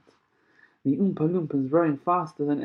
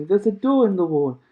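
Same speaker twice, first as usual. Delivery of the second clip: higher, louder eager call; excited cry; 5 semitones higher, 6 dB louder than usual. A narrator's reading. Charlie showing Grandpa the door, excited. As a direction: blurt it out thrilled. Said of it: "cried Charlie".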